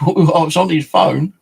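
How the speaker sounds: loud, high incredulous retort; retold protest. Protests he was reachable, retold.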